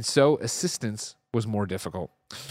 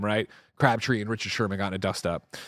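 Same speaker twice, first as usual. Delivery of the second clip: normal talking voice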